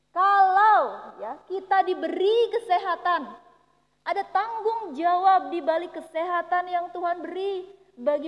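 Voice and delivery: fervent preaching at full force. Acted. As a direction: preach it with rising passion. Impassioned sermon to the congregation.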